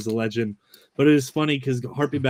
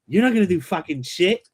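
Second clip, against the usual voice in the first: put-on a cocky kid brother, higher and sneering; lightly voiced taunting sibling; mock bratty retort; 6 semitones higher than usual. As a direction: fire it back like a smug little brother. Brother retorting, acted quote.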